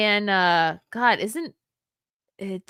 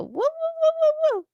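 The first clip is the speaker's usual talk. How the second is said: mocking dog bark. Shown as a demonstration. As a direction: bark to make fun of the dog. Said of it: "I do it like I'm mocking Waffles".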